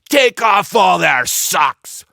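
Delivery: loud, slow shouted command; battle order. A narrator's reading. Joe ordering his crew, loud and slow. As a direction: bellow the order across the deck. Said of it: "Joe cried out".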